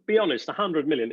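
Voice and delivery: ordinary talk, nothing marked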